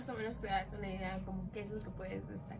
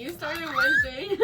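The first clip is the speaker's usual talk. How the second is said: higher, louder laughing tease; 9 semitones higher, 9 dB louder than usual. Real, not acted. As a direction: laugh out the accusation. Laughing accusation of friend.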